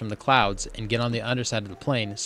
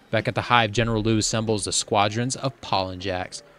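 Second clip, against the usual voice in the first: ordinary delivery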